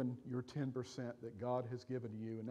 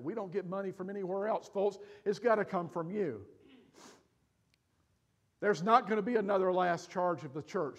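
loud, higher-pitched pulpit urging; raised, emphatic preacher voice; impassioned preaching; 6 semitones higher, 7 dB louder than usual. Real, not acted. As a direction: preach it forcefully to the congregation. Preaches at full force.

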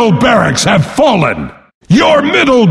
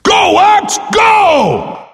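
much higher, shouted self-cheer; pitched-up rallying cry; excited cheer; 10 semitones higher, much slower than usual. Acted. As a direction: cheer yourself on like a fan. Cheering himself on.

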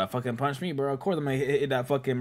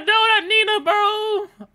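pleading at screen; 5 dB louder, slower than usual. Real, not acted.

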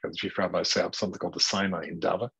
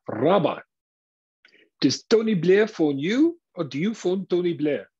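higher, louder South African voice of the little fellow; a pushy questioner's raised South African query; South African accent; 9 semitones higher, 4 dB louder than usual. Retold or imitated. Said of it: "in a South African accent".

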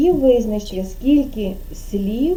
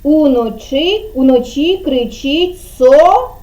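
slow "уночі кричить", hanging on rising "со-"; leaving word unfinished; 2 semitones higher, 3 dB louder, slower than usual. Shown as a demonstration. Teacher prompting a child to finish, slow with suspended "со-". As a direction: stretch the cue and stop on "со-". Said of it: "а ви будете закінчувати".

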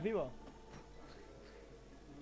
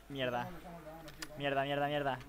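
normal talking voice

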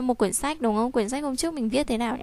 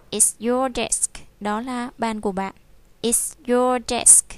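slow drill reading; slower than usual. Shown as a demonstration. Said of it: "teacher drills".

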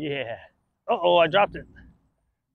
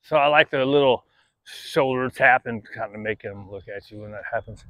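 ordinary talk, nothing marked

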